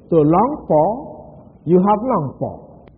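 To long for, you have longed for, drawn out slowly; slow emphatic quote. Shown as a demonstration. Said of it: "That's the way he said".

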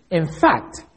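"in fact" set apart with a firm fall on "fact"; emphatic IN FACT. Shown as a demonstration. Teacher showing what the emphasis is for, emphatic. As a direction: land on "fact" and let it drop. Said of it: "That's what emphasis is for".

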